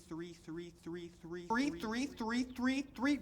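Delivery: recurring number trailing off upward; endlessly repeating digits. Acted. Reels off a repeating decimal.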